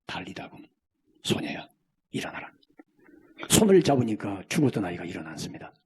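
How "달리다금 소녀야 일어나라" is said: hushed whisper of Jesus. Acted. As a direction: breathe the command softly over the girl. Jesus speaks to dead girl. (예수님의 속삭이는 명령)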